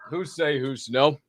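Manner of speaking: usual speaking voice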